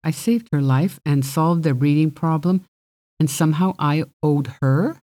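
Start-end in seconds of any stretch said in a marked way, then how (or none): none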